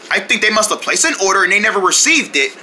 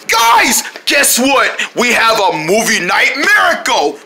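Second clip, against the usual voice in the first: excited announcing; slower than usual. Acted. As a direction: burst in with big news. Announcing to friends.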